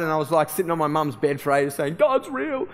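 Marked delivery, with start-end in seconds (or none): 2.0-2.7 high, mock-weepy voice; retold crying, pitched high; imitated tearful cry. Son sitting on mum's bed for ages, imitated tearful cry. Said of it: "And so I cried".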